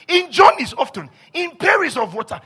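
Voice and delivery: high, loud preacher's recital; rousing pulpit reading at full force; forceful scripture reading. Acted. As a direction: pound out each item of the list. Reads scripture list at full force.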